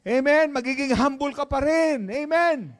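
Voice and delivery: loud, rising sermon cry; preaching at full force. Acted. Pastor urging the church, loud preaching. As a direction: drive the point home to the congregation.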